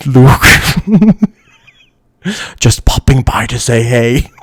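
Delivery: a hint of the breathing villain gone casual; lightly voiced villain cracking up on a friendly hello; Darth Vader. Streamer imagining what else the villain would say, light comic impression. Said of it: "What else would Vader say?".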